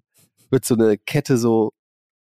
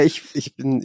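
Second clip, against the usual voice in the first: usual speaking voice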